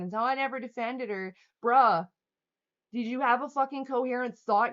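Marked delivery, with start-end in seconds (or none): 1.6-4.7 exasperated shout. Real, not acted. Exasperated at a hostile viewer.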